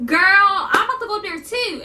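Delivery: ready to march upstairs; backing up the roommate, fed up. Retold or imitated. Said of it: "And I was like".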